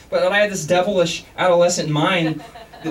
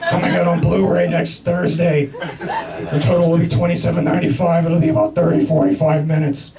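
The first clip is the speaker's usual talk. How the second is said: Batman voice. Retold or imitated. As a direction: keep the Batman growl while giggling. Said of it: "kept doing the Batman voice".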